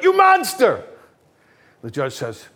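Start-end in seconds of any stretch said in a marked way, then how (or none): 0.0-0.8 much higher, much louder furious yell; outraged scream. Acted. Audience member screaming at the murderer in court, furious scream. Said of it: "one of the guys from the audience screams out".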